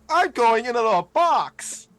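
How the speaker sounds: high, loud, slow gleeful cry; excited squeal. Acted. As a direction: squeal it out with delight. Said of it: "he's just going".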